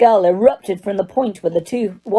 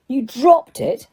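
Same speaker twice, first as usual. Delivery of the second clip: DROPPED pitched up in disbelief; 6 semitones higher, slower than usual. A narrator's reading. Diggory repeats Harry's claim, stressing "dropped" in disbelief. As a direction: echo "dropped" as an incredulous question. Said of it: "repeated Mister Diggory in disbelief".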